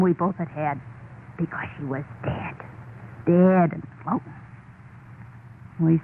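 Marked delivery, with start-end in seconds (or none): 1.4-4.4 hushed confession. Acted. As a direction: drop into a near whisper. Horrified confession of a death.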